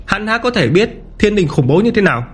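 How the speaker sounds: high-pitched, disdainful follower; scornful henchman. A narrator's reading. Henchmen belittle the outsider's narrow view, scornful and high. Bystanders voiced in the story.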